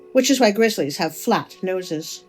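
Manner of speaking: plain reading voice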